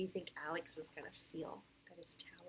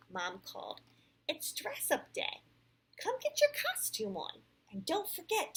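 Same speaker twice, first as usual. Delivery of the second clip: clear, animated read-aloud; storybook voice with lively rises and falls; picture-book narration; 4 semitones higher, 8 dB louder than usual. A narrator's reading. Parent reads Sam's story to a child, animated storytelling.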